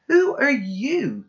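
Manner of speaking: high, loud, slow angry challenge; angry boyfriend confronting an intruder; snarls. Acted. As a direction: snarl at the stranger. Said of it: "he snarls".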